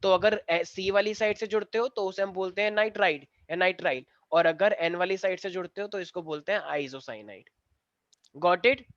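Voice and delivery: ordinary talk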